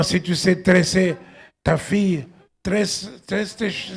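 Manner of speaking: ordinary talk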